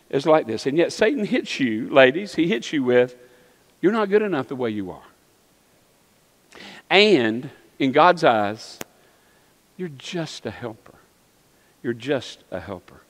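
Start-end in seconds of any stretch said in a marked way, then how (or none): 3.8-13.0 quiet belittling. Acted. Voicing Satan's belittling words.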